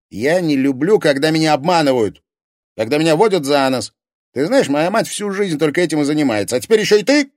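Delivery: high, loud jealous accusation; rising fury, ending in a yell; angry outburst. Acted. Jealous quarrel with Liza.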